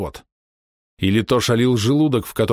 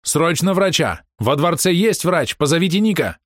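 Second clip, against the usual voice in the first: shouting; 9 semitones higher than usual. A narrator's reading. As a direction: yell for the doctor. Urgent shouts for a doctor.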